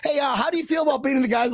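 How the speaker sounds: high, fast, eager question; eager reporter impression. Host mimics reporter questioning a stranger, high and fast. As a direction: pester the star with a question. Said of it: "He's, like, trying to jump up".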